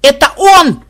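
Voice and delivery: very high, loud squeal; desperate shriek. A narrator's reading. Granddaughter spotting the looter, shrieking. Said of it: "вдруг отчаянно заверещала внучка".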